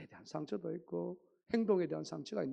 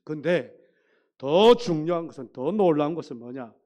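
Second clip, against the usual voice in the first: much louder, driving sermon build-up; preaching emphasis; 14 dB louder than usual. Real, not acted. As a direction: hammer the key point to the congregation.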